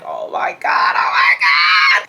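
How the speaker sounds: panicked, breathy mock scream; hammed-up panic with hardly any voice; panicked retelling. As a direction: act out a drawn-out frantic oh-no moment. Retold panic at being caught.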